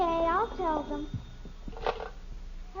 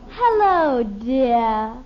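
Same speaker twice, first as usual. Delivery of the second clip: put-on a child aping a grand lady, much louder; mock aristocratic; 7 semitones lower, 8 dB louder, much slower than usual. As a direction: boom out a snooty greeting. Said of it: "she talks just like Betty does".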